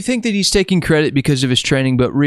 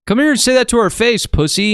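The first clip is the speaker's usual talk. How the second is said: higher-pitched mock taunt; mock challenge; 8 semitones higher than usual. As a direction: playfully dare him to a confrontation. Mock challenge to a disagreeing friend.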